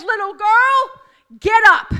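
high, loud command to rise; commanding shout. Acted. Jesus ordering the girl to rise, a loud high shout. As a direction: shout her awake.

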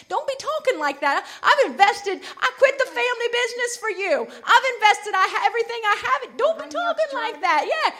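high-pitched, hammed-up pleading complaint; protesting disciple. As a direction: plead like you've given up everything, voice climbing. Peter acted, protesting to Jesus.

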